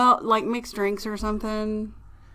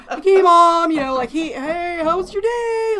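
lightly raised, easy happy voice; cheerful son; 8 semitones higher, 7 dB louder than usual. Retold or imitated. Mother imitating her easygoing son. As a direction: sound sunny and easygoing. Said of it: "Noah's chill".

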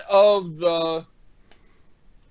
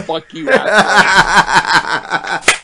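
drawn-out shout; 4 semitones higher, 9 dB louder, much slower than usual. Real, not acted. Playful insult after being teased.

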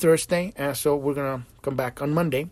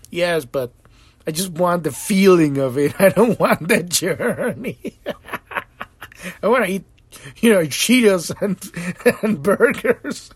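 louder, higher pleading through laughs; laughing plea; 4 semitones higher, 5 dB louder than usual. Retold or imitated. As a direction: beg God while cracking up. Lazy self pleading with God.

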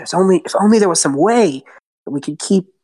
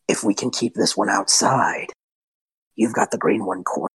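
lower, calm confiding; low steady planning voice; Lothar's voice; 7 semitones lower, 4 dB quieter than usual. Acted. Lothar explaining the plan to the party. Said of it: "returns to Lothar's voice".

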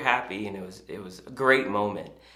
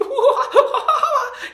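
high, loud imitated child giggle; giggling; 10 dB louder than usual. Daughter delighted by the man eating, giggling squeal. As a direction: burst into a squealing mock giggle.

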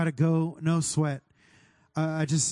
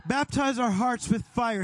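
prayer shout; 7 semitones higher than usual. Acted. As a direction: cry it out with full force. Repeated prayer line, building.

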